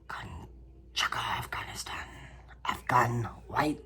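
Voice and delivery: slow hushed whisper; slow mysterious whisper; dramatic whisper. Pretend. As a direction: whisper the name like a secret. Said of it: "I don't know why I said it like that".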